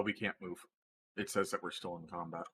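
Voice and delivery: ordinary talk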